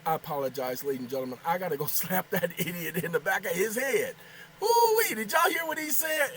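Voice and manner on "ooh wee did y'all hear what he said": much higher, loud astonished whoop; amazed ooh-wee. Real, not acted. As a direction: react in disbelief to the answer. Reacting to AI's reply.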